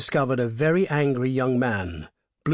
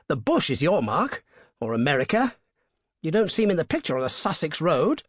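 higher, louder, incredulous gentleman's voice; bluffing young toff; 6 semitones higher, 3 dB louder than usual. A narrator's reading. Motorist protesting to the highwayman, high and incredulous. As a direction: laugh it off as an outrage.

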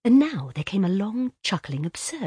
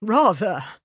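put-on hearty, loud boyish agreement; lightly voiced warm boy; 3 semitones higher, 7 dB louder than usual. A narrator's reading. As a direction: agree warmly, like a keen lad. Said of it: "said Laurie's warm, boyish voice".

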